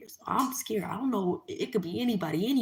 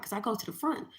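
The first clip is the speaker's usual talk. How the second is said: ordinary delivery, nothing marked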